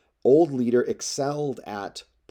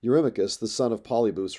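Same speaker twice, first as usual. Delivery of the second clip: ordinary delivery, nothing marked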